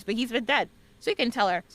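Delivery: usual speaking voice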